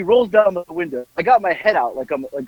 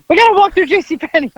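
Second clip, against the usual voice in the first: high-pitched, agitated imitation; Howard; 11 semitones higher, 3 dB louder, faster than usual. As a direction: put on an excitable man's high voice. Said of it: "So he says".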